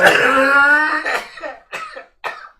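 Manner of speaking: a long loud laugh. Real, not acted. Host laughs as Isley is invited onto the show, laughing.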